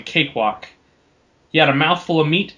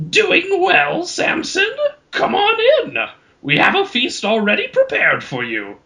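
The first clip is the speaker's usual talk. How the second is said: much higher, raspy withered troll voice; raspy old troll. Acted. Old troll chief welcomes Samson, high raspy croak. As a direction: croak it like an ancient, cheerful troll. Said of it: "rasped Bongo".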